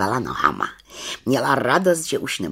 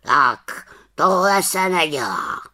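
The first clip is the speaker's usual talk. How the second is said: slow, raised, scolding voice; reproachful raven; 6 semitones higher, 5 dB louder, slower than usual. A narrator's reading. Raven tells off the little witch for spitting, reproachfully. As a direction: scold slowly and firmly, pitched up. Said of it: "řekl káravě".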